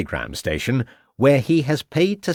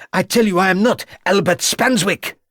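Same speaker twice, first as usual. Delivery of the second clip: put-on high, loud blurt in French accent; 11 semitones higher, 4 dB louder than usual. A narrator's reading. Prisoner to inspector, blurting high and loud. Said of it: "blurted out the prisoner".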